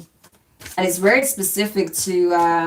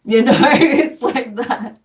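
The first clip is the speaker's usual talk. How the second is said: slow, higher, louder laugh-talk; laughing while talking; 5 semitones higher, 5 dB louder, slower than usual. Real, not acted. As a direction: let laughter carry the words. Laughing at own comeback.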